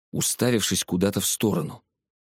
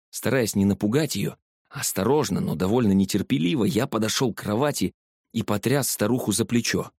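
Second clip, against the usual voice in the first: plain reading voice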